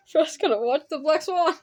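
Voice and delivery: laughing. Real, not acted. Laughing at the movie choice.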